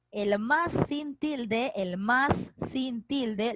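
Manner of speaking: very slow, every word spelled out for notes; slow dictation. Shown as a demonstration. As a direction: say "el más sin tilde" slowly, as if dictating. Teacher dictating a key term. (dictado muy lento y claro)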